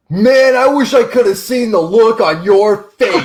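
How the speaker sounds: imitating dad cracking up, loud and high; hammed-up teasing laugh voice; mock gleeful dad. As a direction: crow it through laughter, drawn out slowly. Dad mocks him in retold call.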